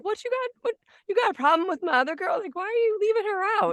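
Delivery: high, fast, sassy telling-off; scolding the neighbor dogs like a mom; mock scolding. Speaking for her dog, high sassy scolding. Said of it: "and I'm like".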